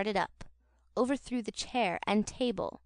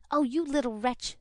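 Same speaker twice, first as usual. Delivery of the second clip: angry scream; 4 semitones higher than usual. Acted. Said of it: "screamed Charlotte".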